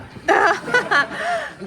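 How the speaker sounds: high, loud burst of laughter. Acted. Laughs to himself mid monologue.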